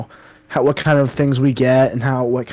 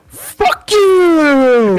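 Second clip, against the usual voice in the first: much higher, louder, drawn-out yell; yelled insult; 14 semitones higher, 6 dB louder than usual. Retold or imitated. Guy from the video, yelling an insult. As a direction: hurl the insult across the street. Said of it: "And he's like".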